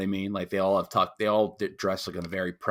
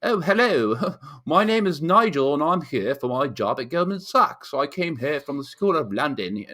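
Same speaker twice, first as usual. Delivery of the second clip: high, louder mock-proper British voice; proper British accent; 6 semitones higher, 4 dB louder than usual. Imitating British expats in New York for the cohost, proper British accent. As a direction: put on a very proper English accent. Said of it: "so they speak, you know".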